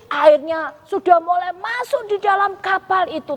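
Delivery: plain reading voice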